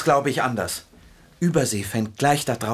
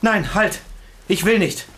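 raised, urgent protest; higher-pitched, pleading refusal; alarmed protest; 6 semitones higher than usual. Acted. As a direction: stop them from leaving. Protesting at being left alone.

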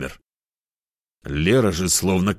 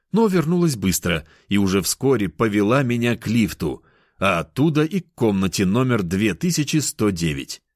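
ordinary delivery

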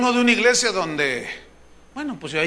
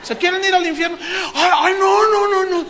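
higher, louder hammed-up protest; mock alarmed crowd; 9 semitones higher, 6 dB louder than usual. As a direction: cry out no in comic alarm. Preacher asks, crowd retracts.

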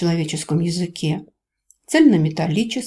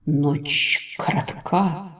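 slow, otherworldly serpent voice; ancient creature voice; 2 semitones lower, much slower than usual. A narrator's reading. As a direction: speak slowly as an ancient spirit. The great Le speaks.

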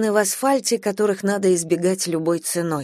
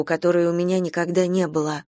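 usual speaking voice

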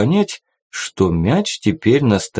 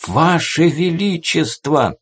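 higher, slow alarmed call; panicked plea; 8 semitones higher, slower than usual. A narrator's reading. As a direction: plead in sudden alarm. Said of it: "панически зашептал телохранитель".

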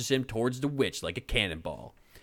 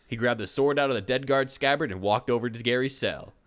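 ordinary delivery, nothing marked

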